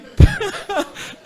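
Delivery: a high, loud burst of real laughter. Real, not acted. Preacher laughing with the congregation at his own joke, loud high laugh.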